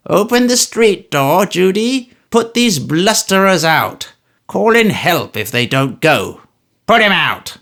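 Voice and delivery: put-on loud, high old man's bellow; Smallweed shouting for Judy to throw them out; vociferating. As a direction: bawl orders at Judy. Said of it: "He vociferates this so loudly".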